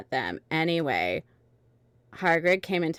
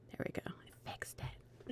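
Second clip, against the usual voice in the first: whisper; 19 dB quieter than usual. Real, not acted. Muttered aside while reading.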